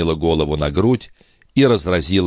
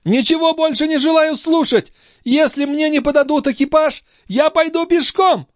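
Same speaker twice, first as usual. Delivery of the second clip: extremely high, hysterical defiance; frantic young mother. Acted. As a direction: refuse to listen, voice at its very highest. (истерически высокий голос упрямой матери)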